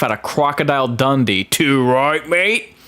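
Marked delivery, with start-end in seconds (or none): 1.4-2.7 light put-on Australian drawl, rising on mate; a playful Aussie bloke impression, moderate; Aussie accent. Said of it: "That's a pretty good Aussie accent".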